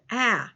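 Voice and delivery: a clear short "Ah," as in accent; short A sound. Shown as a demonstration. Teacher confirming the short A sound to pupils, sounding it alone. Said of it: "a short A sound".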